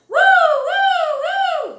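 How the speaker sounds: high, loud siren wail; siren horn. A narrator's reading. Narrator voices the fire engine horn, loud high wail. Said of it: "went the horn".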